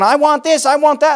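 high mock-whiny kid demands; demanding child. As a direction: nag like a child in a store. Acts children demanding in store.

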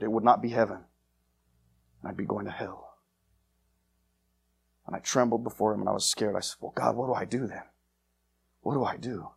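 preacher quietly recalling his fear of God; hushed solemn preaching. Acted. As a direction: bring it down to a trembling hush. Preacher recalls fear, sinks low.

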